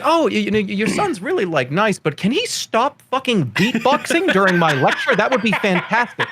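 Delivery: high-pitched mock-polite teacher complaint; laughing through a teacher's plea; exasperated teacher imitation. Imitating his teachers complaining to parents.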